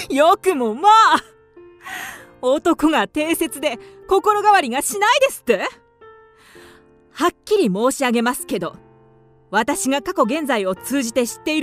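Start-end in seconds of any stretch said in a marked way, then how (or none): 0.1-5.7 high, scornful incredulity, still half laughing; mocking disbelief. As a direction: throw his claim back in ridicule. Widow mocks his claim about men.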